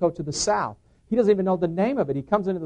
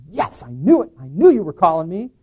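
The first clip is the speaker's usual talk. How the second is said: retold joyful outburst, louder; glad; 8 dB louder than usual. Answering God's call in a retelling, glad. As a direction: exclaim with glad relief. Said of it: "I was just so glad".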